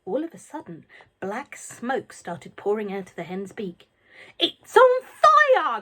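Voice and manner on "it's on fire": very high, loud, slow yell. A narrator's reading. Grandma yelling from the roof at the burning hen. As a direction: cry out in alarm. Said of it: "Grandma yelled".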